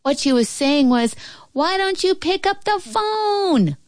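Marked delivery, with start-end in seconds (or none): 1.5-3.8 put-on high, slow girl's holler; high, drawn-out long-distance call; distant shouting. A narrator's reading. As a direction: call it out to someone far away. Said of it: "the little girl shouted even louder".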